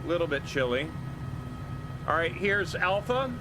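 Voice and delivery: ordinary talk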